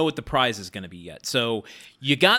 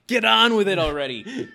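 higher, louder impatient pet voice; impatient cat voice; 7 semitones higher, 4 dB louder than usual. Pretend. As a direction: voice the cat nagging you. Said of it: "The cat is saying".

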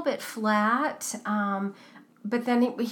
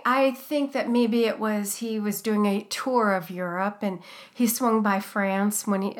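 ordinary talk

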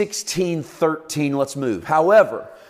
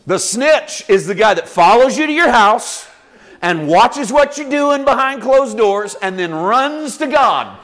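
louder preaching; 6 semitones higher, 6 dB louder than usual. Real, not acted. Preaching with rising intensity.

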